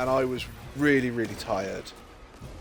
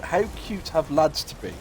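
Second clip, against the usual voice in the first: ordinary delivery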